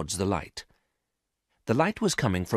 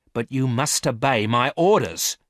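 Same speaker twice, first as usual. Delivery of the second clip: angry command; 4 semitones higher, 6 dB louder than usual. A narrator's reading. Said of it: "said the woman".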